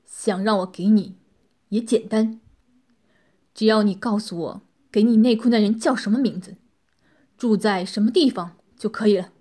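大声而冷硬地逼问; 压着火气，用审讯口吻施压; 强压怒火、冷声. A narrator's reading. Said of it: "冷声道". (强忍怒气冷声说道)

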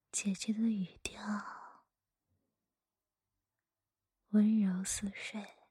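hushed storyteller whisper; whispered story reading. Acted.

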